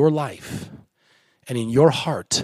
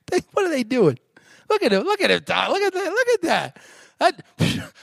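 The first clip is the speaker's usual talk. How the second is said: high, put-on scoffer's voice; mocking onlookers; 10 semitones higher than usual. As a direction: play a bystander jeering at the newcomers. Preacher acts out mocking onlookers.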